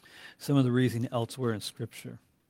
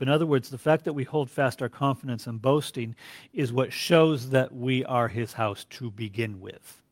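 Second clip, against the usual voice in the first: ordinary talk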